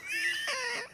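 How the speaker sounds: sudden very high, soft giggle; high squeaky giggle. Real, not acted. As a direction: squeak out a quick laugh. Laughs at being called a twink.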